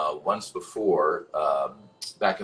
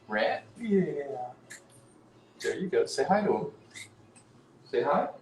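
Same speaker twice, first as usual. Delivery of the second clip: high-pitched cat-coaxing voice; cooing to a pet; pet talk; 8 semitones higher than usual. Real, not acted. Showing the cat to viewers.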